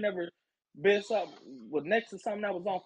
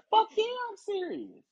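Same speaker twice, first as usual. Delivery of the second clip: higher and indignant; indignant yell; 13 semitones higher than usual. Retold or imitated. Answering the manager on the phone, higher and indignant. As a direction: snap back in disbelief. Retold angry reply.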